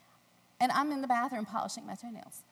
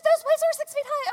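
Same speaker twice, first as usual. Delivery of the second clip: laughing mock outrage; 11 dB louder than usual. Husband scolding, retold.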